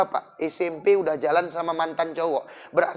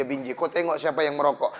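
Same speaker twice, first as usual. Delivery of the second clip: normal talking voice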